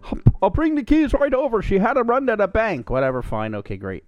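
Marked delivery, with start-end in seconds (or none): 0.0-2.9 lightly hammed-up neighbor bringing keys; old guy. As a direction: put on a high, chirpy voice for the old guy. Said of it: "He goes".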